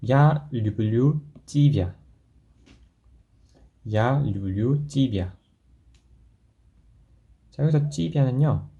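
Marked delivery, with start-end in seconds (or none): 0.0-5.3 each word slow and clear, Я люблю тебя; teacher reading slowly for repetition; slow model sentence. Shown as a demonstration. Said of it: "이렇게 한번 보도록 할게요".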